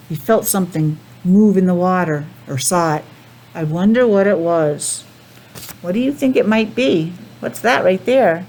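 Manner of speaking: ordinary delivery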